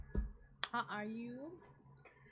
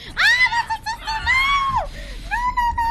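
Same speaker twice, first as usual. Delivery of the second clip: high screaming panic, much louder; panicked scream; 13 dB louder, faster than usual. Real, not acted. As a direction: scream it in real alarm at the mistake. Realises he hit the sister.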